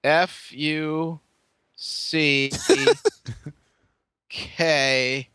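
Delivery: F, U, C, K dragged out one by one; slow spelled-out letters. Retold or imitated. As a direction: spell each letter slowly, with long pauses. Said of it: "he went slower".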